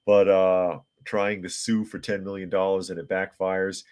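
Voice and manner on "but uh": ordinary talk, nothing marked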